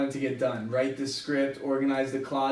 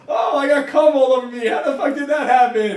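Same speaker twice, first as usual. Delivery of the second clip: mock confused; 10 semitones higher, 10 dB louder than usual. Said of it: "they're like".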